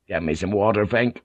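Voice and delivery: ordinary delivery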